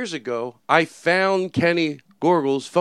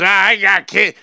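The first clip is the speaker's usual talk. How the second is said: lightly voiced old man, louder; a hint of an old man's holler; 5 semitones higher, 6 dB louder, much slower than usual. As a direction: imitate a loud old man. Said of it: "he was like an old man".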